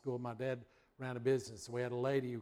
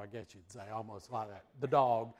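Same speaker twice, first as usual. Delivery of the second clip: ordinary talk, nothing marked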